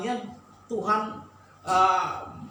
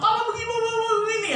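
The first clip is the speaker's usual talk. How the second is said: high-pitched mock nagging; nagging wife; 13 semitones higher, much faster than usual. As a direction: rattle off the complaint again.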